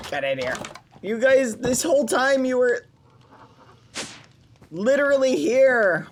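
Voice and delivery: put-on gushing over grown-up kids; happy character voice.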